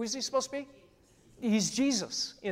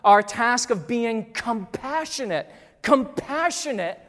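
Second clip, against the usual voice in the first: climbing louder to the final word; preaching build; 3 semitones higher, 7 dB louder than usual. Acted. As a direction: stack the tasks and build.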